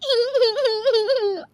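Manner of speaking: long high giggle, falling at the end; habitual giggle. Acted. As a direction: giggle helplessly at the wrong moment. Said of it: "तेरी तो आदत ही है". (बेमौके लंबी, ऊँची खिलखिलाहट)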